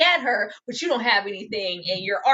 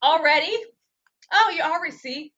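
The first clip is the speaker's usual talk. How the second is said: mock surprise; 6 semitones higher than usual. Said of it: "everybody's like".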